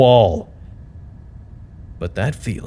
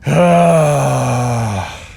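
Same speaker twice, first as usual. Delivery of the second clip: weary sigh; 2 semitones higher than usual. Acted. Worn out after meetings.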